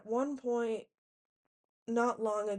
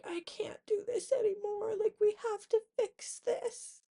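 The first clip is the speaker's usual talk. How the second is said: imitating upset Blaze; 12 semitones higher than usual. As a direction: mimic the boyfriend begging, much higher.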